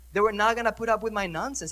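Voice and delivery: ordinary talk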